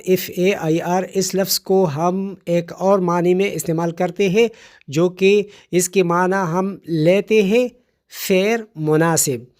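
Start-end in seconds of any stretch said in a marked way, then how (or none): none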